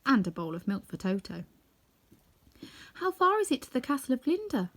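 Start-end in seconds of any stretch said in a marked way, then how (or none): 2.9-4.7 put-on much higher childlike question; high girl voice. A narrator's reading. Dorothy asks the farmer's wife the way: high childlike. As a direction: ask like a curious little girl. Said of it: "requested the kid".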